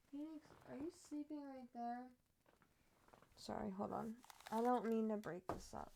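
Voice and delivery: ordinary delivery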